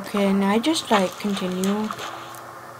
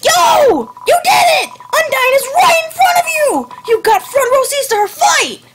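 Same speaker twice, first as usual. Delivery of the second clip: put-on eager kid voice; 5 dB louder than usual. Voices excited game kid character.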